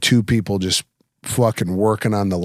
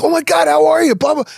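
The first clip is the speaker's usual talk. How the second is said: very high, loud mock enthusiasm; gushing fake greeting; 4 dB louder than usual. Greeting people he doesn't remember, high gushing fake delight. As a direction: gush as if you know them well.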